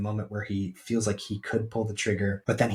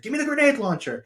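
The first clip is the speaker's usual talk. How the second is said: fast, high, loud mock yell; soldier yelling; 6 dB louder, faster than usual. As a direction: bark the order across the battlefield. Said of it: "one of the soldiers is yelling".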